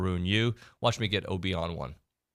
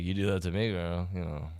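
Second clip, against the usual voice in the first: a hint of a creepy old man, low and soft; lightly voiced creepy old man, trailing off softer; 3 semitones lower, 5 dB quieter than usual. Radio host imitating the reporter's creepy remark, lightly voiced creepy old man. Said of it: "sounded like a creepy old man".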